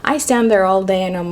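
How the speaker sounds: usual speaking voice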